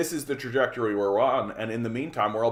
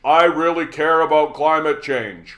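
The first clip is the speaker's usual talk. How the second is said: mock politician pledge; 4 semitones higher, 7 dB louder than usual. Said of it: "say back to them the thing they said to you".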